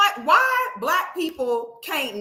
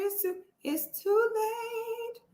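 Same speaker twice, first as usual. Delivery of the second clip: high, soft, drawn-out singsong; teasing high-pitched refusal; mock dismissal; 10 semitones higher, 9 dB quieter, slower than usual. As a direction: croon it slowly, too sweetly. Mock reply to backtracking woman.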